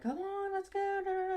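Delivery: sing-song happy call to coax the dog; bright high baby-talk to a dog; really happy. Retold or imitated. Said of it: "I just made it really happy".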